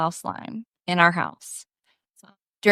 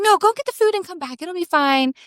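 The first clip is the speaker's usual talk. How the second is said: higher, airy reassurance; lightly voiced breezy mom; 9 semitones higher than usual. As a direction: brush it off lightly. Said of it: "And she's like".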